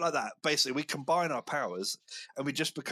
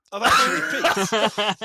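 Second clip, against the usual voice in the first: laughing shout; 7 semitones higher, 10 dB louder, slower than usual. Retold or imitated. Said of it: "I looked up and I thought".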